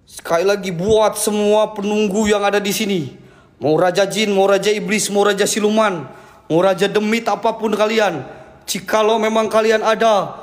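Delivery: ritual challenge. Real, not acted. Ghost hunter summoning the spirits, low and grave.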